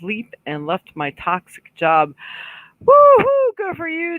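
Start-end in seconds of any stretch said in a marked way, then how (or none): 2.8-4.2 high, loud whoop of joy; excited cheer. Real, not acted. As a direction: cheer her on like a fan. Cheering viewer who quit job.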